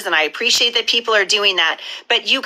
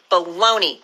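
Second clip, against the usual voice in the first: normal talking voice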